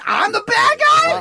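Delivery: high, slow hammed-up wail; mock crying. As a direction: whine it through fake tears. Mock crying indignant cheater.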